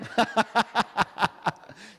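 real high burst of laughter; laughing. Real, not acted. Laughing at raised hands.

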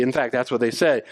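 usual speaking voice

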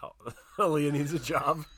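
usual speaking voice

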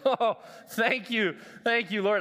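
slow, drawn-out sigh of relief; mock relieved thanks. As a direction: thank God like a weight just lifted. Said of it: "I was like".

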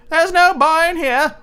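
wife lying to the giant; giant's wife. Acted. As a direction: squeak out the lie innocently. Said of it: "The wife said".

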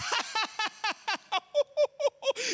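a high, cackling mock laugh; imitated laugh of wife. Husband mimics wife laughing at his choking, high cackle. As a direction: copy her howling laughter, pitched high. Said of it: "she's just losing her chops over it".

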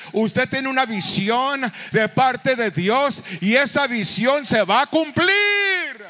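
loud, slow, rising exhortation; preaching at full force. Acted. Preacher shouting encouragement to the congregation, at full force. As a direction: drive it home to the congregation.

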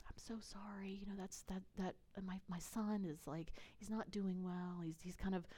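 very soft, gentle retold apology; sweet apology. Woman apologising to a stranger about her son, sweet and soft. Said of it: "she's very sweet".

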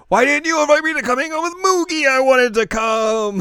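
high, loud whining complaint; a high, loud, jealous whine. Retold or imitated. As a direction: whine about being left out. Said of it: "is like".

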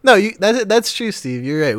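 loud, high sarcastic concession; sarcastic agreement. Acted. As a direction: concede with heavy irony.

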